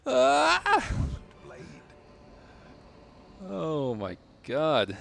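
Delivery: very high, loud joyful shriek; giddy relief. Real, not acted. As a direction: scream out the victory. Boss defeated, shouting in joy.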